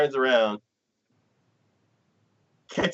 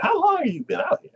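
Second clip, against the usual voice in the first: high, incredulous question; mock disbelief at the creep; faster than usual.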